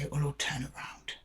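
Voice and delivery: a hushed, wishful whisper to herself; whispered hope. Retold or imitated. Reader voicing Aquarius clinging on, whispering. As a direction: breathe it out like a secret hope.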